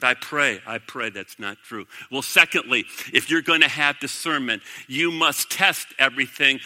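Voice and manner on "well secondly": normal talking voice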